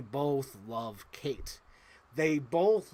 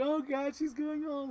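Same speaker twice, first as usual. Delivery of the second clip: high, soft, moved voice; getting emotional; 11 semitones higher, 3 dB quieter than usual. Real, not acted. Movie fan moved by Kate going home, getting emotional. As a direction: voice lifts high as feeling wells up. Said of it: "I'm getting emotional just thinking about it".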